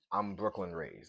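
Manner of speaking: ordinary delivery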